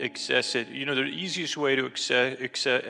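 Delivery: plain reading voice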